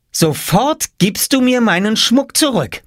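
put-on very high angry girl voice; angry demand. A narrator's reading. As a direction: demand it back. Jule demanding.

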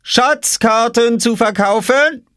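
very high, stretched vendor's cry; teasing, drawn-out sales call. A narrator's reading. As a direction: sing out your wares slowly, rising at the end. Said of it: "rief Oskar".

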